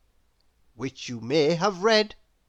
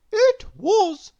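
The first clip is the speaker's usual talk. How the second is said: tired little miss; 13 semitones higher, 4 dB louder than usual. Acted. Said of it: "said Little Miss Whoops".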